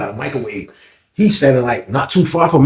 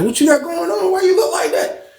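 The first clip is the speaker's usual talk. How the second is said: worried; 12 semitones higher, 4 dB quieter than usual. Retold or imitated. Worried question to friend.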